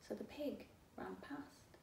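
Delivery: breath it out; whispered. A narrator's reading. Narrator tells the pig ran past, whispered.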